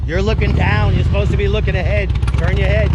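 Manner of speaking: loud, high calls across the range; yelling to rider. Real, not acted. Instructor yelling to a student on a motorcycle, loud and high. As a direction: shout so the distant rider hears. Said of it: "they think I'm yelling at the riders".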